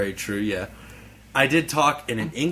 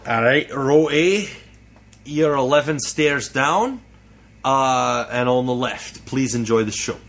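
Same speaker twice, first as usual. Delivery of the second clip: put-on Scottish accent; 2 semitones higher than usual. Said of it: "I talked in a Scottish dialect".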